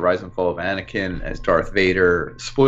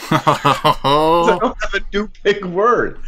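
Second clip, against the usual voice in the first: slow hammed-up sulk; mock sulky; 7 semitones higher, 4 dB louder, slower than usual. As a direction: whine it slowly.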